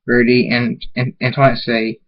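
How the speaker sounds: ordinary delivery, nothing marked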